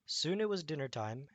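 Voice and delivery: ordinary delivery, nothing marked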